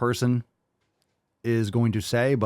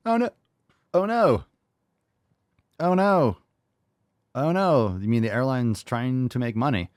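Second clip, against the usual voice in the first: mock dismay; 4 semitones higher than usual. Mocking the clip's complaint about airlines making money, sarcastic mock dismay. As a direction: fake alarm, rolling the eyes at it.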